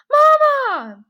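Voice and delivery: high, loud cry for his mother; desperate shout. Acted. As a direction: scream out for Mama across the field. Boy sees mother, cries out.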